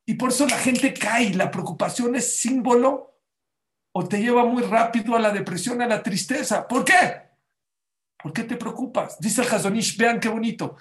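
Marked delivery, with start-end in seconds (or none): none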